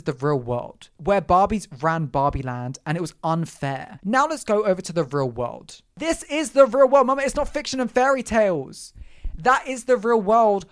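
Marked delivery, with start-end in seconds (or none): 6.0-10.7 sassy outburst. Real, not acted. Ranting at critics.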